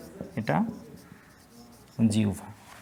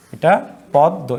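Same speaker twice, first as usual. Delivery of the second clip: usual speaking voice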